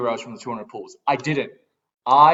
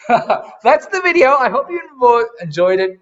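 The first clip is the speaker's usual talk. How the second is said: louder, higher, laughing sign-off; cheerful laughing outro; 10 semitones higher, 9 dB louder than usual. Real, not acted. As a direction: wrap up the video with a laugh.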